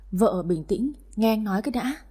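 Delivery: ordinary delivery, nothing marked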